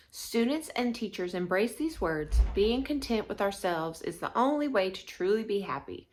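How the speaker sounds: ordinary delivery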